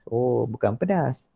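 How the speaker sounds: ordinary talk, nothing marked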